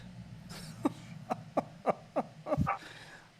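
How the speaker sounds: high, breathy villainous goddess laugh; a cackle of dark amusement; evil laugh. Acted. Goddess of darkness laughs at the hero, high evil laugh. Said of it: "I gotta do this voice again".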